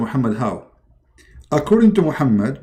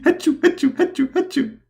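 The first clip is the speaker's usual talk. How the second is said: high forced sneeze sounds; fake sneezes; 13 semitones higher than usual. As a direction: sneeze on purpose, hammed up. [name] forcing sneezes.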